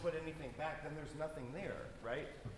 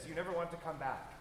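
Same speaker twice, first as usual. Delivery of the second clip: ordinary delivery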